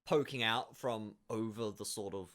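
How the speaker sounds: ordinary delivery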